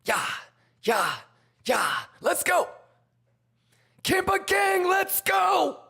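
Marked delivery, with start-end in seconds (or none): 2.2-5.8 hype shouting. Real, not acted. Giveaway hype.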